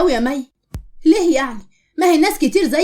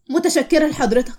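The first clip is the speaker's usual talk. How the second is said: usual speaking voice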